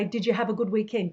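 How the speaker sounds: fast, run into one word; "Did you have a good weekend" squashed fast into one word; said quickly. Shown as a demonstration. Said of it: "Australians when they say".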